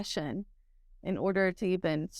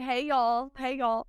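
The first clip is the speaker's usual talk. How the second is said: Texas greeting; 5 semitones higher, slower than usual. Retold or imitated. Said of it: "I always say".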